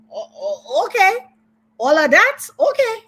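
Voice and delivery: high stammering okay, leaping up in disbelief; mock astonishment. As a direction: sputter like you can't believe all that. Reacting to her flashy lifestyle.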